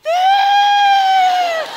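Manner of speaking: a very high, loud shriek of alarm; startled scream. Retold or imitated. Storyteller spots a giant ship, high alarmed shriek. Sees ship coming at her.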